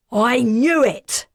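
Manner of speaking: furious male shout, loud; angry shout. A narrator's reading. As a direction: yell it in betrayed rage. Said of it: "a man shouted".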